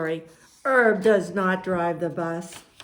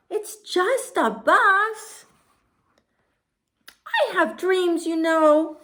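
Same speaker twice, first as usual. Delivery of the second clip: high pigeon voice, pleading and drawn out; wheedling pigeon; 13 semitones higher, 5 dB louder than usual. Acted. Pigeon tries to convince us with silly things, high pleading voice. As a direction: whine and bargain in a high squawk. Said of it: "he tries to convince us by saying".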